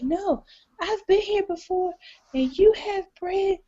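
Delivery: high, slow insistent; insistent diner. Retold or imitated. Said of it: "She's like".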